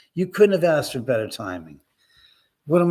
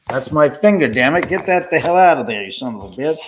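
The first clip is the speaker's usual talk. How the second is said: loud, exasperated cursing; annoyed outburst; 7 dB louder than usual. Real, not acted. Host scolding their own finger in the shot, exasperated. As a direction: curse loudly in irritation.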